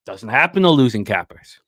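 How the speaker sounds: normal talking voice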